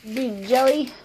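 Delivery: pet talk. Real, not acted. Owner playfully teasing her jealous pets, high baby talk. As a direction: tease the piggy and kitty in a high voice.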